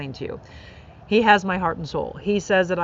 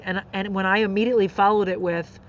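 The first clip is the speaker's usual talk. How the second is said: ordinary talk, nothing marked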